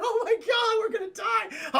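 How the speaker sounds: high, loud hammed-up panic; panicked screaming. Friends trapped in the garbage chute, mock screaming panic. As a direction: wail in mock terror, drawing out the end. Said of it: "They're screaming".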